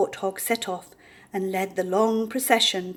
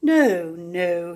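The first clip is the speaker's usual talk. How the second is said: grunted; 2 semitones lower, 5 dB louder than usual. A narrator's reading. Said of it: "he grunted".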